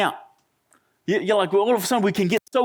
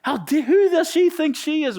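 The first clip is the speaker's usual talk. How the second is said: indignant; 6 semitones higher than usual. Retold or imitated. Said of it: "He goes".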